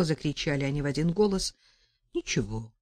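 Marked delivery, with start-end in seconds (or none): 2.1-2.7 quiet, calm reply. A narrator's reading. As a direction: say it coolly, as if nothing happened. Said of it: "спокойно ответил Олег". (спокойно, тихо)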